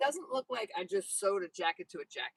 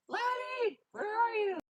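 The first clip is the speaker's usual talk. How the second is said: much higher sing-song call; calling the horse; 12 semitones higher, slower than usual. Real, not acted.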